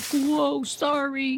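high, soft, drawn back apology; sheepish little brother. Acted. Brother to Dad after the suitcases fly off, soft high sheepishness. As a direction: apologize small and high, softly. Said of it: "Johnny's like".

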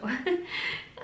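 short high chuckle; quick amused laugh. Real, not acted. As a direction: chuckle briefly at your own joke. Laughs at own joke.

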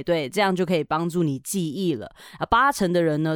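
usual speaking voice

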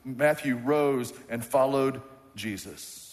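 slow, weighty emphasis on rose; each word laid down slowly, rose stressed; slow emphatic reading. Shown as a demonstration. Pastor to congregation, slow and emphatic. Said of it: "I'm not losing my mind".

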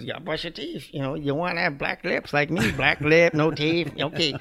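high, cheeky Yorkie voice; talking dog voice. Retold or imitated. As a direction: voice the dog teasing the boy. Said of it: "She goes".

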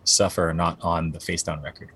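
ordinary delivery

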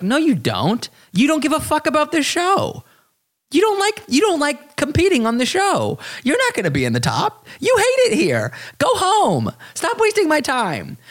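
high-pitched exasperated rant; mock rant. Vlogger voicing an imagined rant at the contestant, high and exasperated. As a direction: tell her off in a shrill mocking voice.

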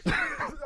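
soft, breathy burst of laughter; real laugh. Real, not acted. Host hearing another butterface joke, laughing. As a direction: crack up quietly at the joke.

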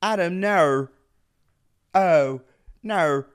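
loud, high attempt at Australian; hammed-up Australian accent. Shown as a demonstration. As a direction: put an Aussie R on the end of "no". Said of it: "say in Australian speak".

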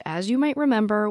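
usual speaking voice

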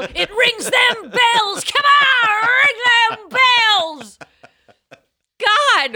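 slow, high, loud shouting. Real, not acted. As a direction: belt it out with glee. Hyped shouting about the pantsuit.

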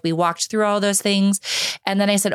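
ordinary talk

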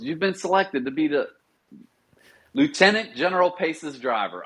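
put-on military announcing voice; imitating sergeant major. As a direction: raise the pitch to deliver the verdict. Sergeant major announcing selection, acted.

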